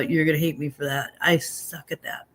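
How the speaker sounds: ordinary talk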